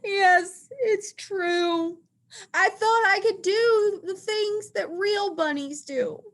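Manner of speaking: high crying wail; crying elephant admitting the truth. Acted. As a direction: wail the confession. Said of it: "He wails".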